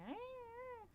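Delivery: soft, very high squeal; thin high animal whine, kept quiet; monkey-noise cat. Retold or imitated. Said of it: "he makes these monkey noises".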